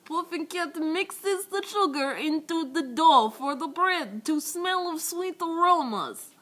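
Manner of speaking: high, loud, slow storybook narration; hammed-up cartoon narrator with a giggle behind it. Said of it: "And then it's like".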